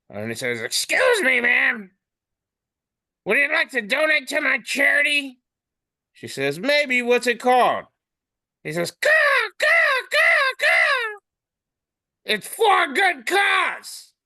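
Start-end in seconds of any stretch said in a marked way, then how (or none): 0.6-5.4 high, squawky crow character; talking crow. Acted. Crow asking a woman for charity, high squawk. 6.6-7.9 high-pitched put-on woman's voice; woman in comic. Woman replying to a crow's pitch, high voice. 8.9-14.1 very high, loud squawks; cawing crow. Acted. Crow pitching its cause to a woman, squawked.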